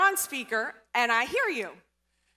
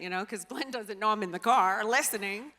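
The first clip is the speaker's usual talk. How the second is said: usual speaking voice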